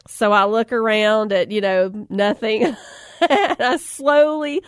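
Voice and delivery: ordinary talk, nothing marked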